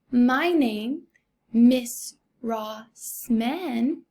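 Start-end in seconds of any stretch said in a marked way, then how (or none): 1.5-4.0 Miss Rossman, very slow, split into three beats; syllable by syllable. Shown as a demonstration. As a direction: say "Miss Rossman" as Miss, Ross, man with gaps. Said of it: "I hear three vowel sounds".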